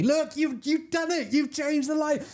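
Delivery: high, delighted squeal; thrilled squeal. Real, not acted. Streamer squeals at chat changing his lights. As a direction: squeal with joy at the viewers.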